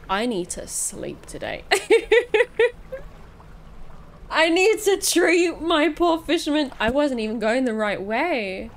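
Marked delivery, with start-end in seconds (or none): 1.7-4.3 high, loud burst of giggles; nervous giggle. Real, not acted. Player needing sleep in the game, nervous giggle. 4.3-6.7 high, loud lament; laughing dismay. Real, not acted. Laughing pity for own character.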